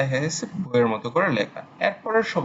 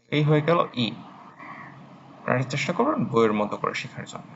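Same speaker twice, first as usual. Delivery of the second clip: normal talking voice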